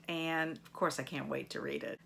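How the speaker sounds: ordinary talk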